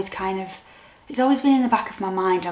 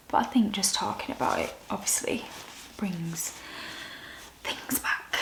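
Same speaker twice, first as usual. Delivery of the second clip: soft, barely voiced through tears; fading into tears, much softer; real choked-up softness; teary; 6 dB quieter than usual. Real, not acted. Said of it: "got a bit teary".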